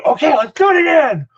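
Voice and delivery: hollering coach, high and loud; Dick Adams. Retold or imitated. Coach drilling the freshman lineman, high hollered command. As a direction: holler the order across a practice field. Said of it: "he kept saying".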